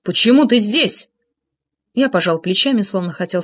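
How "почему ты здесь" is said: trying to sound menacing; raised pitch, forced threat in the voice; mock threatening. A narrator's reading. Tarasov challenging the narrator, attempting a threatening tone. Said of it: "стараясь придать голосу угрожающий тон".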